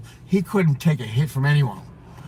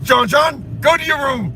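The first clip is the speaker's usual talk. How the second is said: higher, firmer stern dad order; imitating the father; 7 semitones higher than usual. Retelling the father scolding John John, mock stern. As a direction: play the strict father sending him off.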